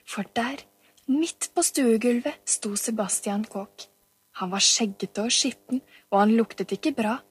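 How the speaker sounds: usual speaking voice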